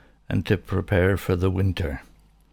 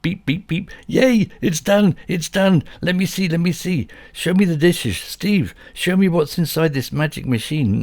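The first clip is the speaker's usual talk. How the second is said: high, loud thrilled dog; a little dog bouncing with joy; excited dog voice; 12 semitones higher, 4 dB louder than usual. A narrator's reading. Dog Bobby cheers that the dishwasher is done, high and thrilled.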